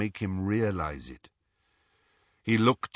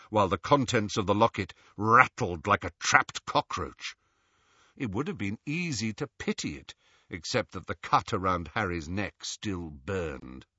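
ordinary delivery, nothing marked

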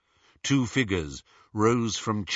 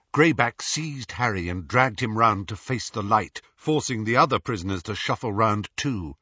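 plain reading voice

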